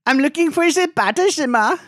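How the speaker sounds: very high, loud caricature voice; mock tourist. Teasing friend's German, shrieky-high mock tourist voice. Mocking co-host's poor German pronunciation.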